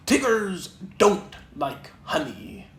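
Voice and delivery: slow, firm Tigger verdict; very decided. Acted. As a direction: declare it with no room for doubt. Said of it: "in a very decided voice".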